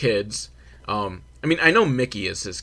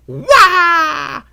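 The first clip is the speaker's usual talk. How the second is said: loud, high karate yell; high-pitched imitated battle cry; mock karate cry; 10 dB louder than usual. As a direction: let out a hammed-up martial arts shriek. Mimics the fighter's karate attack cry.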